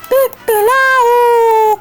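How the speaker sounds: long high crowing call; stretched rooster cock-a-doodle. Pretend. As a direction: crow like a rooster at dawn.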